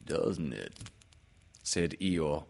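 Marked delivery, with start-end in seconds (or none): 0.1-0.7 low, subdued donkey voice; low storybook donkey; satisfied donkey. A narrator's reading. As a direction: drop low and murmur it contentedly.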